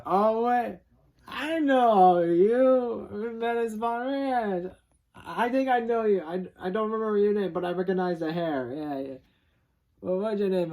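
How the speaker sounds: high, mock-bright surprised Tex; surprised recognition. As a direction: play Tex suddenly placing a face. Tex recognizing someone, retold.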